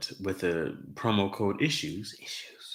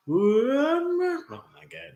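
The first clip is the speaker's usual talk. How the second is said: startled; 4 dB louder, much slower than usual. Real, not acted. Flashed himself with the light.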